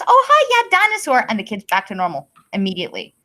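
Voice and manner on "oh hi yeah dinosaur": much higher, louder sing-song mother; sing-song mom voice. Retold or imitated. Mother warmly greeting her child again, in a high sing-song. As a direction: coo it like a mom reengaging her toddler. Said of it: "she goes".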